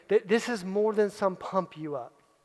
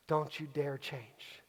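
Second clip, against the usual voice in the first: slow hushed command; 7 semitones lower, 13 dB quieter, much slower than usual. Acted. Coach repeats the command three times.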